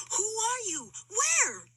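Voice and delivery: high, scared calling up; a startled elephant calling out; startled question. A narrator's reading.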